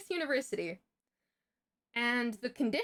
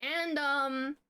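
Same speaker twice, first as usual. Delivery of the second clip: usual speaking voice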